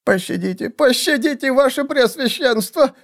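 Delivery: very high, frantic begging. A narrator's reading. Assassin begs seer for mercy, pleading. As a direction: beg for mercy in a squeaky voice. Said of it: "взмолился наёмный убийца".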